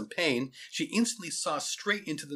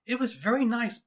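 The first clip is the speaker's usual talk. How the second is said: high, courteous woman's voice; polite young teacher; 10 semitones higher than usual. A narrator's reading. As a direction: answer the principal in a high, polite voice. Teacher answers the principal.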